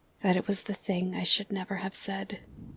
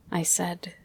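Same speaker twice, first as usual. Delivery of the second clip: ordinary delivery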